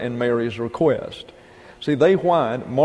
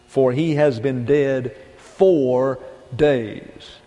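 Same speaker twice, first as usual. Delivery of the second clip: "For he has been dead four days." drawn out very slowly, "has" weighted; slow dramatic reading; much slower than usual. Acted. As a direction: read each word heavily and slowly. Reading Martha's words dramatically.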